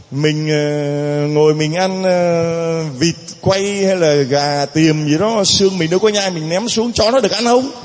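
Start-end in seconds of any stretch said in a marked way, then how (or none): none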